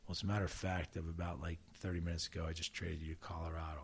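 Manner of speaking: low, hushed retelling of bad news; grave, serious. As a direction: break it softly and soberly, as the manager. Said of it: "serious. And he said".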